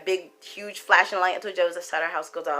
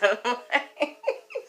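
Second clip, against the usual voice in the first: real laugh; 4 semitones higher, 4 dB quieter than usual. Real, not acted. Laughing at the memory.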